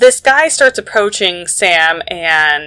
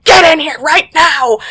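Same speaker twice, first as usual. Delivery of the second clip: urgent shout; 7 semitones higher than usual. Retold or imitated. Agent yells at them to get in.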